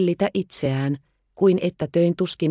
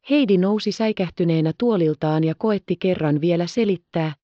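plain reading voice

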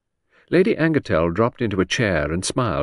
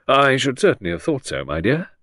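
stiffly; 6 semitones higher than usual. A narrator's reading. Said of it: "said Sir Henry stiffly".

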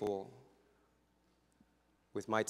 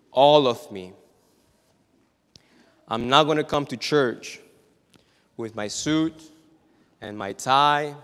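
much louder, booming preacher; preaching at full force; 10 dB louder than usual. Acted. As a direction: thunder it from the pulpit.

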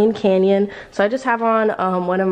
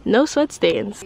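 ordinary delivery

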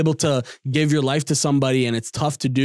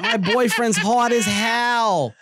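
high, slow, hammed-up Boston girlfriend accent; much higher put-on Boston accent; mock Boston accent; 8 semitones higher, much slower than usual. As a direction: ham up a whiny Boston accent. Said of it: "that annoying ass accent".

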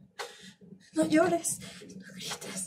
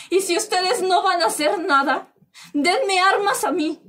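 loud, higher, slowing on "denme armas a mí"; furious demand; 5 semitones higher, 4 dB louder than usual. Acted. A woman demanding weapons from the authorities, furious and loud. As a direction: hurl the demand at them.

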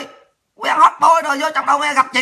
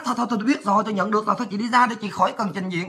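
lowered voice, repetitive brush-off; imitating hurried dismissal; 3 semitones lower, 7 dB quieter, faster than usual. Officer sending the woman away from reporting, softly dismissive. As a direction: mimic an officer quietly waving someone off.

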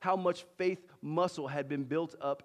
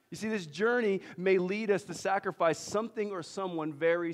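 preaching intensity; 5 semitones higher, 6 dB louder than usual. Real, not acted. Preaching at full force.

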